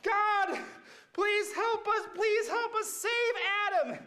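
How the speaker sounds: high, mock desperate begging; pleading prayer. Friends praying to save Adam, mock pleading. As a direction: beg God high and frantic. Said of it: "and then they're like".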